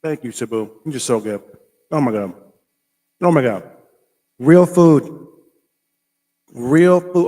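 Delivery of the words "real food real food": loud, delighted cry of real food; excited exclaim. Real, not acted. Vlogger tasting mango, thrilled and loud. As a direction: shout it out with joy.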